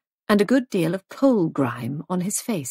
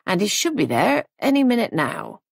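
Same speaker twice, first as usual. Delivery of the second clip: usual speaking voice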